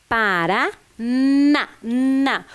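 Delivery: slow "Paraná, na" with a long n opening into na; sounding out. Shown as a demonstration. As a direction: lean on and hold the n of na. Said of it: "Olha o som muito próximo". (prolongando o "n" de "na")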